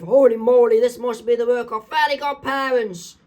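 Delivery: high, loud cartoon exclamation; hammed-up astonished shout; villain's outcry. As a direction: cry out in high alarm. Comic villain exclaims at fairy powers.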